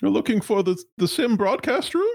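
high, nervous corporate stammer; a flustered high-pitched executive. Acted. GM holds executive voice.

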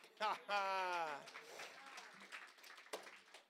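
long breathy laugh trailing off. Real, not acted. Man retelling an answered prayer, laughing. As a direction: laugh softly and let it run out.

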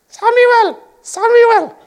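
a squealing, silly voice of God; squeaky voice. Acted. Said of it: "So imagine he's got a squeaky voice".

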